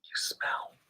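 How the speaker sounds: whispered punchline. Acted. Reader whispering the joke to children. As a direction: breathe it out as a secret.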